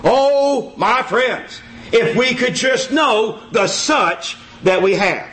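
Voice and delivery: normal talking voice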